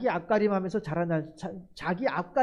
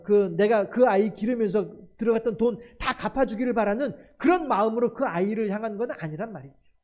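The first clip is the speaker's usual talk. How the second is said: usual speaking voice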